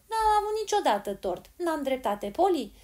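put-on high, loud child's protest; exclaiming. A narrator's reading. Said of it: "exclamă Joel". (exclamație de protest, pe ton înalt)

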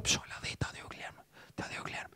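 whisper. Retold or imitated. Fan nudging girlfriend, spotting him.

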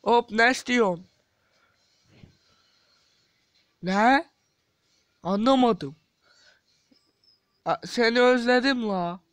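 yüksek sesle karakter taklidi; bağırarak kısa dublaj repliği; dublaj sesi. Acted. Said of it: "sesini taklit edeceğim". (yüksek sesli karakter dublajı)

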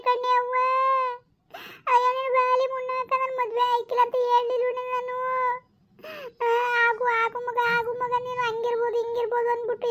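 high, drawn-out weeping complaint; wailing daughter. Acted. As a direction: wail it out like a ruined young wife crying to her mother. Daughter laments to her mother.

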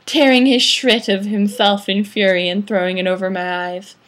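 plain reading voice

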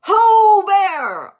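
high, loud searching call; long-drawn holler for a lost friend. A narrator's reading. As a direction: holler it out across a distance. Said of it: "They call".

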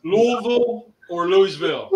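first Louisville slurred "LOO-vul", second full "LOO-ee-vil"; contrasting pronunciations. Shown as a demonstration. Friend asks which way is right, Louisville as "LOO-vul", then "LOO-ee-vil". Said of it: "How do you pronounce".

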